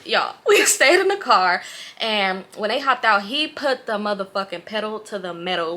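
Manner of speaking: usual speaking voice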